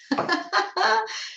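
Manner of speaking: high, bubbling laugh; real laugh. Real, not acted. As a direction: laugh off the confusion, pitched high. Baffled by the glitch, amused.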